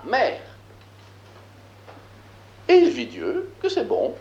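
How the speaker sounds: measured, declaiming biblical verse; solemn scripture reading. Acted. Teacher reading Genesis aloud to the class, solemn scripture reading. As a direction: read it like sacred text, weighty.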